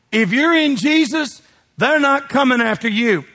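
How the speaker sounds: ordinary talk, nothing marked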